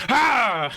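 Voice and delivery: sudden loud, high mock yell; Odin's yell. Host imitating Odin cutting Loki off, a sudden yell. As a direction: bellow one wordless shout. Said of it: "Odin's yell at Loki is funny".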